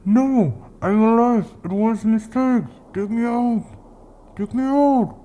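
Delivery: high, desperate pleading from below; pleading buried woman. Acted. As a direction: beg to be dug out. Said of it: "begged and pleaded".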